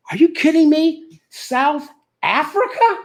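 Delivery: very high-pitched comic disbelief; incredulous. Acted. Comic reacting to an offer from South Africa, incredulous. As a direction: pitch it way up in mock astonishment.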